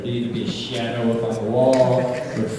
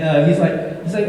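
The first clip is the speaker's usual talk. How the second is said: normal talking voice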